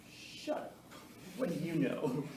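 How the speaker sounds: half-voiced comeback after him; mock defiance, starting almost voiceless; dismissive retort. Student retorting after the professor walks away, dismissive and half-voiced.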